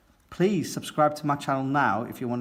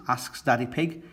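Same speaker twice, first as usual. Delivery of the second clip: ordinary delivery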